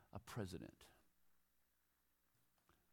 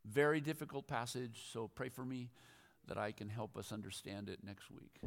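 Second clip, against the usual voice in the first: ordinary talk, nothing marked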